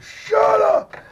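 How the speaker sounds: sudden high, loud yell of "Shut up!"; retold scream. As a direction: snap it out in panic, loud and high. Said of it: "I was like".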